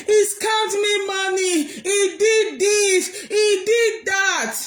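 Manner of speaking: mock her wailing accusation, drawn out; mimicking Priscilla. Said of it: "Priscilla came out the first day".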